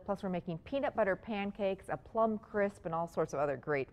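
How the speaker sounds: ordinary talk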